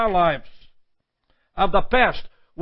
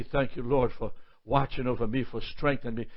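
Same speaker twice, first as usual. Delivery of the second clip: soft prayer; 7 semitones lower, 9 dB quieter, faster than usual. Retold or imitated. Quoted relieved prayer.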